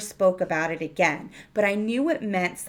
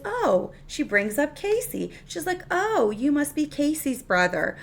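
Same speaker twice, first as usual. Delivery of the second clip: bright surprise; 7 semitones higher than usual. Retold or imitated. Alice recognizes Max, retold.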